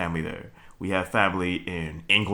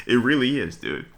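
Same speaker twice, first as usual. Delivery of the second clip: usual speaking voice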